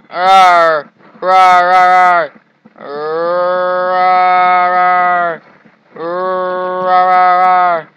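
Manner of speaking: mock monster roar. Playing a hungry monster worm.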